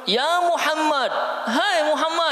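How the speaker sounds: high, drawn-out call of a rough Bedouin. Retold or imitated. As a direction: call out to him from afar. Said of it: "manggil bagaimana?".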